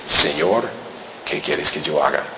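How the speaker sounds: bow your head and ask it low; humble, head bowed. Acted. Saul humbly bowing before the Lord, low and soft. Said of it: "con toda humildad baja la cabeza".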